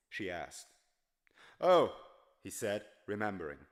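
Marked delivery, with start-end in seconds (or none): 1.6-2.0 a sudden high, loud "Oh"; startled oh. Acted. Tramp startled out of thought, a sudden oh. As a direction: caught off guard, blurting it out.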